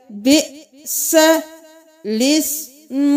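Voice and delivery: slow, drawn-out melodic recitation; Quran recitation. Shown as a demonstration. Teacher recites the verse slowly for students. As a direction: chant the verse slowly and tunefully.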